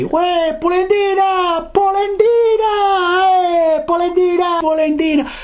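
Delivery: very high, drawn-out teasing little voice; high taunting call. A narrator's reading. Neighborhood taunt to enrage Geppetto, very high drawn-out call. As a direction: call the nickname in a piping, stretched wail. Mocking nickname called out, acted.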